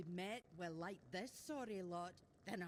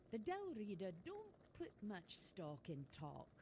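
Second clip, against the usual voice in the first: usual speaking voice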